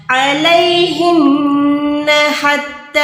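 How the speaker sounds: علیہن with a long ghunna on the نون, very slow; slow ghunna demo; nasal hold. Shown as a demonstration. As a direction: draw out the doubled نون in علیہن. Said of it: "نون پہ گننا کریں".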